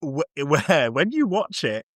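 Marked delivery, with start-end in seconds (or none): none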